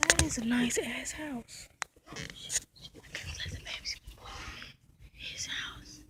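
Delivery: hushed whisper in the car. Real, not acted. Girl whispering to her camera inside the car. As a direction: whisper so nobody outside hears.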